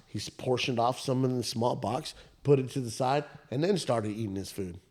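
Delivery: ordinary delivery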